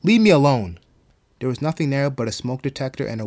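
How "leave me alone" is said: loud, angry yell. A narrator's reading. Marigold yelling into the empty hallway. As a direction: yell it out to drive them off. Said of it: "yelled into the hallway".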